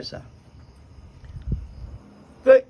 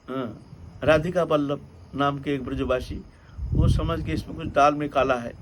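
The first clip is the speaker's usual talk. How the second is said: usual speaking voice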